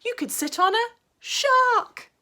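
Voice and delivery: high, loud, slow punchline; dog's punchline. Acted. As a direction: land the rhyme big. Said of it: "said the dog".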